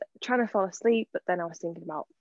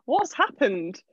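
laughing; 8 semitones higher, slower than usual. Real, not acted. Trying to stop laughing.

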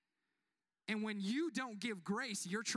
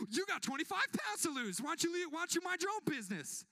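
pitched way up, louder, indignant comeback; fired up; 10 semitones higher, 3 dB louder than usual. Retold or imitated. Husband defending the preacher, fired up and much higher. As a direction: snap back at the mustache critic, high and heated. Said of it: "Pastor Taylor gets a little fired up".

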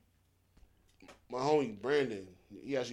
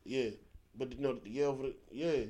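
mumbled slang; 5 semitones lower, 9 dB quieter than usual. Retold or imitated. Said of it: "we have a whole conversation saying".

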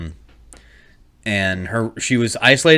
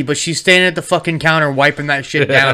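ordinary talk

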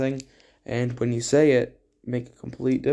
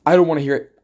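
loud "I don't wanna hear it" with "don't" punched; mock dismissive shout; 3 semitones higher, 7 dB louder, much faster than usual. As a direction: bark "I don't wanna hear it" louder to shut them down. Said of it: "And I'm like".

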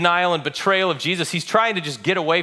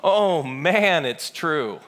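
dismayed realization; much slower than usual. Acted. Peter dreading facing Jesus again, dismayed. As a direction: let the dread sink in as the pitch drops. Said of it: "then part of him's going".

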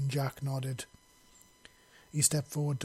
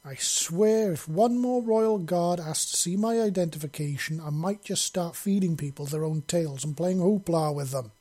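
raised irritated snarl, a bit higher; fed up and griping, voice raised; 4 semitones higher, 6 dB louder than usual. Acted. Tick griping to Jack about guards, snarling. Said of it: "Tick snarled".

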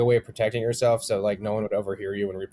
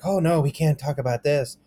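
higher-pitched, mildly panicked imitation; mock startled; 4 semitones higher than usual. Imitating people afraid of getting canceled, mock startled. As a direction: back away in pretend alarm. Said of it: "you know, startled and like".

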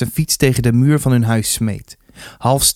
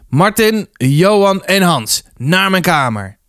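high start, falling firmly; stern summons; 6 semitones higher, slower than usual. A narrator's reading. Rector summoning three fighters, stern order. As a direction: order them off, name by name.

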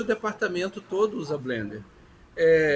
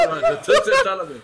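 high, loud, laughing declaration; laughing loudly; 8 dB louder, faster than usual. Real, not acted. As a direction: burst out with it through laughter. Joking about sitting at table.

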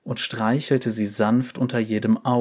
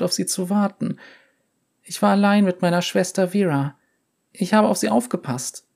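put-on high, fast girlish voice; young girl voice; 9 semitones higher, faster than usual. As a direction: tell it as a scared young girl. Girl tells her story.

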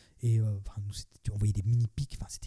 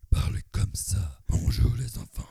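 low, slow cartoon villain; 4 semitones lower, slower than usual. Shown as a demonstration. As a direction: growl out a kids' show baddie. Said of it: "c'est moi qui ai fait la voix du méchant".

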